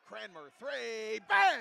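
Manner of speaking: booming call on the made three; excited shout. Real, not acted. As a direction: yell it as the shot drops. Big three-pointer called.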